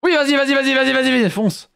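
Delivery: high, loud urgent cheering; excited cheering. Real, not acted. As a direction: yell them forward, thrilled. Urging teammate on.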